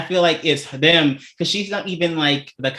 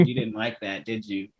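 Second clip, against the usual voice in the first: quiet teasing; 5 semitones lower, 9 dB quieter than usual. Retold or imitated. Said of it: "And I said".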